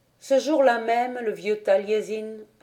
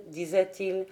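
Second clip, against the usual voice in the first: usual speaking voice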